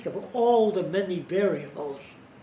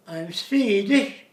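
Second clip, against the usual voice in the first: put-on slow, sing-song Swedish accent, louder; 4 semitones higher, 7 dB louder, slower than usual. Lecturer imitating a Swedish speaker's rhythm, Swedish accent. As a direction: drawl it like a Swede. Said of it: "Swedish speak like that".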